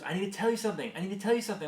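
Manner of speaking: high, insistent toddler imitation; little daughter. Daughter stopping dad at the door, imitated urgent. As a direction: imitate a small child eager to tell. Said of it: "She goes".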